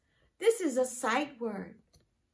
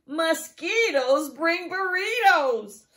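louder, laughing through the rhyme; laughing while reading; 5 semitones higher, 9 dB louder, slower than usual. Real, not acted. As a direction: crack up at the silly rhyme. Teacher laughs reading funny rhyme.

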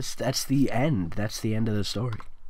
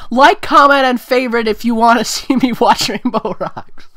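much higher, louder excited pitch; hyped-up plea; 9 semitones higher, 10 dB louder than usual. Real, not acted. Vlogger pitching a live commentary to viewers, loud and excited. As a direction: shout it like a fired-up promo.

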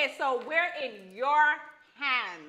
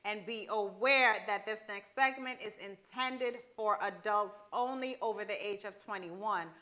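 soft, slow formal disclaimer read; 4 semitones lower, 5 dB quieter than usual. Acted. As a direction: read it like fine print. Reading legal disclaimer.

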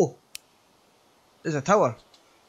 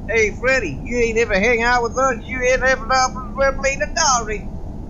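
put-on American accent, slightly raised pitch; 4 semitones higher than usual. Gamer voicing a friend's invitation to go camping, put-on American accent. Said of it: "That's my American accent".

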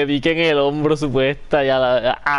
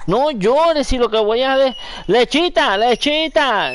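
arrullo a bebé; 10 semitones higher, 3 dB louder, slower than usual. Real, not acted. Soothing crying baby with milk. (voz aguda de hablarle a un bebé)

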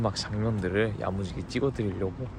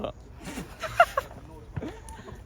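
high breathy laugh. Real, not acted. Caught faking the deep voice.

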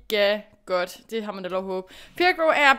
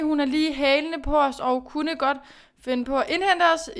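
ordinary talk

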